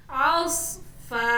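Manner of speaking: "ausfa" ending on an open, held a; waqf reading. Shown as a demonstration. Teacher repeating the stopped form, "ausfa" with long a. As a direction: stop on "ausfa" without any final n. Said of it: "ketika wakof bacanya menjadi".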